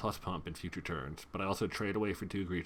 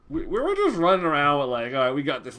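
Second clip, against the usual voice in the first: excited rush; 6 semitones higher, 11 dB louder than usual. Real, not acted. Describing own team's confidence.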